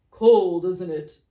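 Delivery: loud sudden remark; change the subject bluntly; brisk businessman. Acted. Second businessman remarking on cold, loud.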